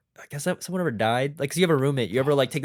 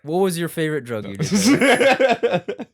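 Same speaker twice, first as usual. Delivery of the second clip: slow and louder, laughing through it; 2 semitones higher, 6 dB louder, slower than usual. Real, not acted. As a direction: ask while cracking up. Laughing question.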